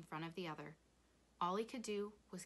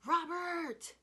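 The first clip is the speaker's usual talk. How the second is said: loud high call across a distance; 11 semitones higher, 10 dB louder than usual. A narrator's reading. Father calling Rob from the motel, calling out. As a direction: call the boy home at full voice. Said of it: "his name came floating to them".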